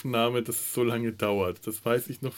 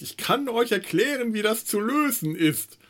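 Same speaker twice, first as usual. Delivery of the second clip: quoting a listener; 10 semitones higher than usual. Retold or imitated. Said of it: "er hat gesagt".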